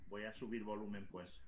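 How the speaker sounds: faint, distant, fading to a whisper; barely audible, far from the mic. Real, not acted. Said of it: "se te oye muy bajito".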